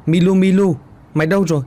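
calling out. Acted. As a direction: call out for the missing pet. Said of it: "lên tiếng gọi".

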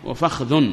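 وفخذ as "wa-fakhdhun"; short a, no vowel after kh in وفخذ; third dialect form. Shown as a demonstration. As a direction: say وفخذ as fakh-dhun. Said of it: "ثلاث لغات".

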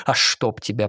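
plain reading voice

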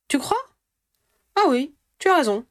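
lightly voiced young girl agreeing; Ginny's voice. A narrator's reading.

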